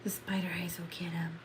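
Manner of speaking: soft spooky whisper; creepy whisper. Pretend. Warning viewers the spider eyes will get him, eerie whisper. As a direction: hiss a threat under the breath.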